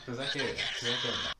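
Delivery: laugh, then a teasing fuzakeru na; laughing it off with a friend; playful laugh. Shown as a demonstration. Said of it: "if your friend is messing with you".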